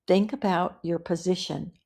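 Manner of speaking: ordinary talk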